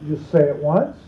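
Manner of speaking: normal talking voice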